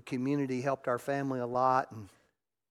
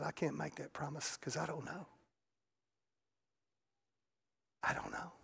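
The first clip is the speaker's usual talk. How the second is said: quiet broken; 11 dB quieter than usual. Retold or imitated. Sad reply to crying son.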